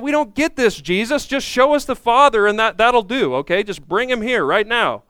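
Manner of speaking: high, exasperated mock pleading; impatient demand. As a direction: push for it like a fed-up disciple. Acting out Philip's impatient demand.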